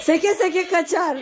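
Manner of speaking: loud laugh through words; laughing shout. Real, not acted. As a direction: shout it while cracking up. Laughing at the hopping wolf.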